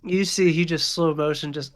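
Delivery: normal talking voice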